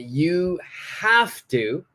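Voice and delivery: very slow, "have" lifted high and held; emphasis on HAVE TO. Shown as a demonstration. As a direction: stretch "have to" out slowly. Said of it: "I emphasize the word have to".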